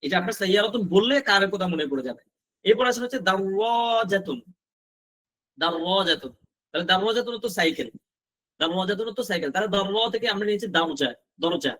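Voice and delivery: ordinary talk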